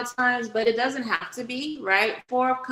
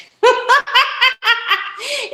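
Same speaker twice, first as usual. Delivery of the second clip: embarrassed laugh; 8 dB louder than usual. Real, not acted. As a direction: burst of high embarrassed laughter. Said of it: "what the fuck am I trying to say".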